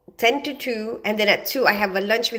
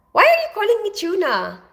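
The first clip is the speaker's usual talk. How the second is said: high, loud, why are you calling me that; incredulous question; 11 semitones higher, 4 dB louder than usual. Real, not acted. Puzzled at a viewer calling her Tuna. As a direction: pitch jumps up in disbelief. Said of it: "reacting to viewer nickname".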